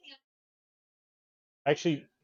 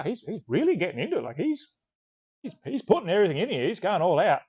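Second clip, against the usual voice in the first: higher-pitched, impressed retelling; amazed; 7 semitones higher than usual. Retold or imitated. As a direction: marvel at it in a raised voice. Retells own amazed reaction while listening.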